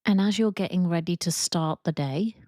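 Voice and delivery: calm, even guided meditation voice with long pauses; guided visualization. Acted. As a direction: ease them into imagining, leaving space after each prompt.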